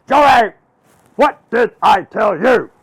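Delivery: high, loud, slow scolding; Miss Dill Pickle. Acted. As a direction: scold the child word by word. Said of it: "She goes".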